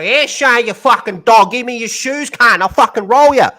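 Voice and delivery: loud, high Australian bogan taunt; Aussie bogan Optimus. Retold or imitated. Optimus Prime taunting Megatron, loud Australian bogan yell. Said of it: "some Australian culture ... and go".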